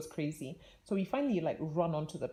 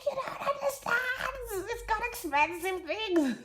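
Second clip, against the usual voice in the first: high, slow, pleading whine; mock-frantic plea about the presents; panicky plea; 3 dB louder, slower than usual. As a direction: wail it like the world is ending. Retold panic to air hostesses.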